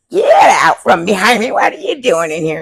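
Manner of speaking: shouting at her to get out; retold yelling. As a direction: snap loudly, half real half mock. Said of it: "start yelling at me".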